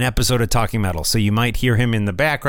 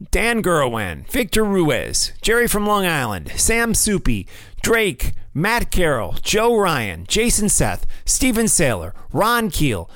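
each name pitched high, then swooping down; high, sing-out supporter shout-outs with falling endings; announcer roll call; 6 semitones higher than usual. Real, not acted. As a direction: call out the names like an emcee.

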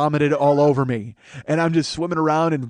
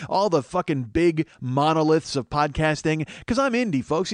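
ordinary talk, nothing marked

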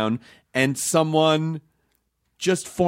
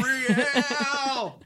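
ad tagline voice; 7 semitones higher, 3 dB quieter than usual. Pretend. Mock ad tagline.

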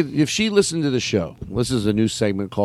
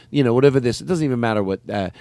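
ordinary delivery

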